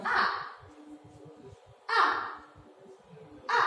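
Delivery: A dropping sharply; falling fourth tone. Shown as a demonstration. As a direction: drop A hard from the top. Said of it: "The sound falls down".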